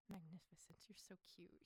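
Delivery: low, soft, creepy murmur; creepy admirer. Retold or imitated. Love interest admiring her in panda ears, creepily. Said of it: "making him sound creepier than he actually is".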